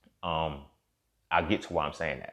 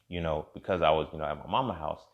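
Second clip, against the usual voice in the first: usual speaking voice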